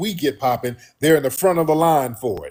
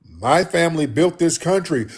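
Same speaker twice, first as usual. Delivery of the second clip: ordinary delivery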